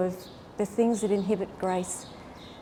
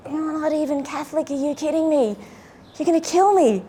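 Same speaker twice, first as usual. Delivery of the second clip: incredulous protest; 6 semitones higher than usual. Retold or imitated. Husband answering wife's demand to be asked, incredulous. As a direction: play up his exasperated disbelief. Acted incredulous reply to wife.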